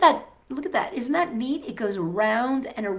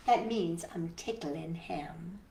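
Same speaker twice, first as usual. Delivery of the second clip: whispered aside; 7 semitones lower, 10 dB quieter than usual. Real, not acted. Teacher whispering an aside to the children. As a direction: whisper it like a little secret.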